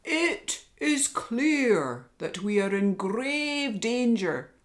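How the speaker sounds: loud, slow, high lion voice; lion's roar. Acted. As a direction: boom out the warning like a lion. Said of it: "roared the lion".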